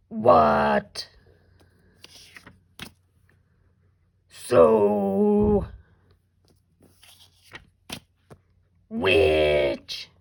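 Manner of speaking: loud, drawn-out monster growl. Shown as a demonstration. Teacher reviewing sight words with kindergarteners, monster voice. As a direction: growl each word by itself, long and loud. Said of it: "use our monster voice to read them".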